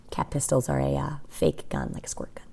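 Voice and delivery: whispered explanation; reader's hushed aside; quiet aside. Real, not acted. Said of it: "aside to listeners".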